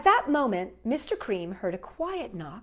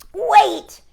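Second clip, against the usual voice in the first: much louder, higher cry to stop her; cried out; 11 semitones higher, 15 dB louder than usual. A narrator's reading. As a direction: call out wait before she leaves. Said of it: "she cried".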